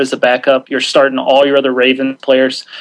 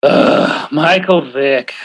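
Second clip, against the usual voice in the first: weary, slow, groaning the name; exasperated groan; much slower than usual. Real, not acted. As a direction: sigh it out like a headache. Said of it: "what a headache".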